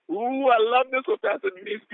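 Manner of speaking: high, thrilled exclamation; excited delight. Real, not acted. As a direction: gush over a favorite quote. Delighted by the quote.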